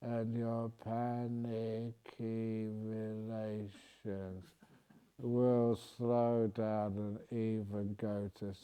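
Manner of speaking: very slow, low and soft; slow low calming voice. Shown as a demonstration. Soothing panicky family members, very slow and soft. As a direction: draw each word out to lull them. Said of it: "in a slow voice, in a low tone".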